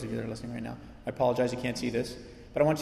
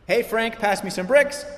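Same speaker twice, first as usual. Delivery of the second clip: calling out; 7 semitones higher, 5 dB louder than usual. Retold or imitated. Builder calling to his buddy.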